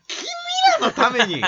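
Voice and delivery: slow, high theatrical cry; catchphrase tone. Acted. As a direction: draw it out high like a grand declaration. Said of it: "結論もそのトーン". (高く伸ばした芝居がかった叫び)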